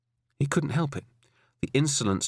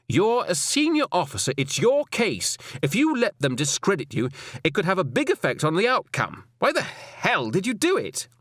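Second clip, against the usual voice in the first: higher, pointed woman's voice; stern female superior; 8 semitones higher than usual. Acted. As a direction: scold a junior officer from above. Alison scolds Roy.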